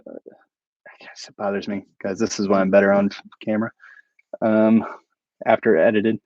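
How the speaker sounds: breathy whisper, half to himself; whispered aside. Real, not acted. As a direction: confide it under your breath. Self-deprecating aside to chat.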